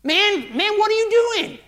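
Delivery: high, loud, exasperated shout; unhesitating rebuke. Acted. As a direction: challenge him at full volume. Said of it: "Jesus doesn't even hesitate".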